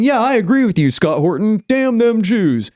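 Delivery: high, put-on sneering troll voice; mock bot voice. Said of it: "bot farms full of people saying".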